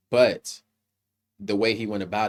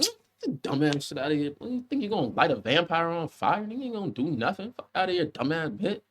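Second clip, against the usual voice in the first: higher, dismissive put-on voice brushing off the attack; mocking the vampire; 6 semitones higher, 4 dB quieter than usual. Reviewer voices vampire scoffing at the Molotov, higher and mocking. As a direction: sneer it like the unbothered vampire, tailing off softer. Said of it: "Bruh said".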